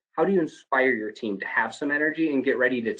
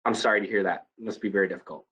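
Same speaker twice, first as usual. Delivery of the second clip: empathetic tone; 3 semitones lower, 3 dB quieter, faster than usual. Shown as a demonstration. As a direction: let your voice carry the sorrow. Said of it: "show empathy with your tone".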